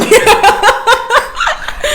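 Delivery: high squealing laugh. Real, not acted. Laughing at own retold story.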